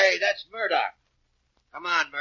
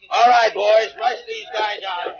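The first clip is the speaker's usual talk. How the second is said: louder, higher barked command to his men; shouted order; 7 semitones higher, 8 dB louder, slower than usual. Acted. Inspector ordering his men to drag the gang out, shouting. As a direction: order the boys to haul them out.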